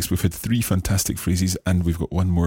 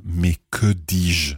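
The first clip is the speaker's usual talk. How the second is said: slow; much slower than usual. Shown as a demonstration. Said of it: "let's think about it first of all literally".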